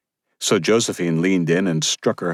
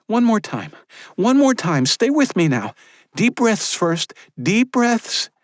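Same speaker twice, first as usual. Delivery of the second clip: higher, louder midwife urging her to stay awake; urgent midwife; 3 dB louder than usual. Acted. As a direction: keep the mother awake, pleading. Maria voiced by narrator.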